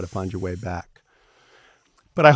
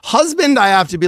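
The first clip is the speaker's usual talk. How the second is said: much higher, loud exclamation; high emphatic; 13 semitones higher, 9 dB louder than usual. Real, not acted. Setting up his joke about husbands, exclaimed high. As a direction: fling the word up. Jumps up to make a point.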